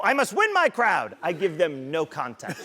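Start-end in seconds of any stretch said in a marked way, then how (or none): 0.0-1.1 high, intense vow; solemn borrowed motivational line; earnest mock Ray Lewis. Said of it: "I go".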